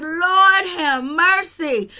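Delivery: slow, drawn-out, loud and high; amazed exclamation. Retold or imitated. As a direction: wail it in delighted astonishment. Retells amazed reaction.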